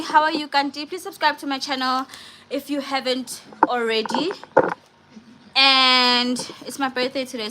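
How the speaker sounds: ordinary talk, nothing marked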